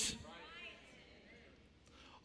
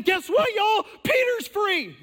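very high, loud, breathless announcement; girl running in with the news; excited shout; 12 semitones higher, 8 dB louder, slower than usual. Acted. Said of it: "A girl runs in".

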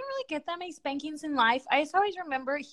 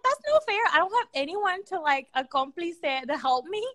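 high, louder mock protest; hammed-up kid's grievance, pitched up; childish complaint; 6 semitones higher, 4 dB louder than usual. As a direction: whine it like a jealous little sister. Acting out her childhood complaint.

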